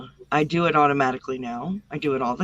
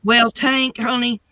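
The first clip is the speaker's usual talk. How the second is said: put-on country Southern accent; 5 semitones higher than usual. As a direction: let the Southern accent slip out, a bit higher.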